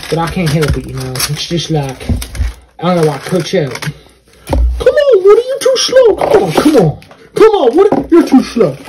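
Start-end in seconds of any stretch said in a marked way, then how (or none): 3.8-8.8 high impatient voice. Acted. Character rushes Woody.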